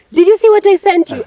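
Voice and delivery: high, loud, eager blurt; eager quote. Retold or imitated. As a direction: jump straight in, high and loud with excitement. Friends skipping greetings, acted quote.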